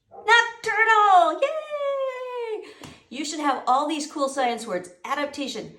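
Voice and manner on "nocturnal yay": high, loud, drawn-out celebration; excited cheer. Real, not acted. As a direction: whoop it out like a big win. Celebrating the right answer.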